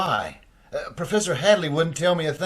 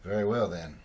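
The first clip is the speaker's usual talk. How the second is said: much lower, much softer surrender; defeated; 11 semitones lower, 13 dB quieter than usual. Acted. Richard cornered by the debts, quiet defeated surrender. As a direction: give in under your breath.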